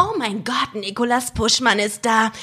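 put-on langsam gedehnt wie ein schwärmendes Highschool-Girl; übertriebene Teenie-Film-Schwärmerei; Highschool-Film-Stimme. Shown as a demonstration. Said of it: "reden kann wie in Highschool-Filmen". (wie im Highschool-Film gesprochen)

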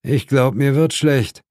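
ordinary delivery, nothing marked

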